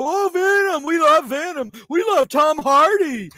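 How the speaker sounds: high, loud mocking fan gush; shouted mock fan adoration. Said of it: "you had to all go".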